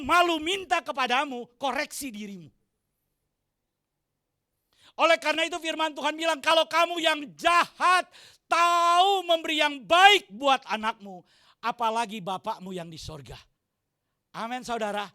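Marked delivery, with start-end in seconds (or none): 5.0-13.4 full-force preaching. Acted. Pastor exhorting the congregation with full force. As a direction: preach it out to the church.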